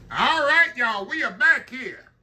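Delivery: high, loud cheerful return; excited welcome back. Real, not acted. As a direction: burst back in with energy. Returning from break, greeting.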